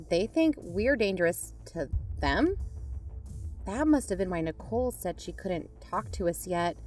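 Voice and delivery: normal talking voice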